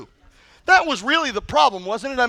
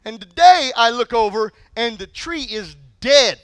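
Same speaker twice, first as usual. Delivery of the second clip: normal talking voice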